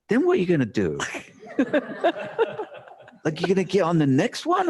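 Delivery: high-pitched, disbelieving challenge; incredulous self-questioning. Retold or imitated.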